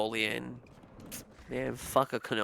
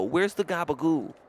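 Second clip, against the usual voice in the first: loud, higher Italian American holler; Italian American guy; 5 semitones higher, 7 dB louder than usual. Retold or imitated. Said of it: "whenever you hear the Italian guys go".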